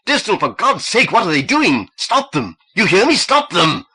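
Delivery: very high, loud frantic pleading; desperate shout. A narrator's reading. Husband begging Distel to stop the killing, high loud shouting. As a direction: beg him to stop it.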